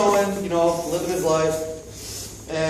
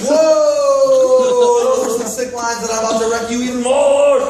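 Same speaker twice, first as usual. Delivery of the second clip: higher, loud, excited yell; loud shout; 7 semitones higher, 8 dB louder than usual. Acted. Prince shouting back at Marshall's rap, high and loud.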